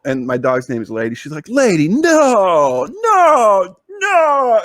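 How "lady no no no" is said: high, slow, drawn-out cries of no; wife yelling at the dog outside, frantic and drawn out. Retold or imitated. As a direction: shout at the dog to stop it, rising in panic.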